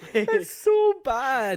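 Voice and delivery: high, loud, drawn-out disbelief; amused outburst. Real, not acted. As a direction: burst out, stretching the words high. Laughing disbelief at cheesy film line.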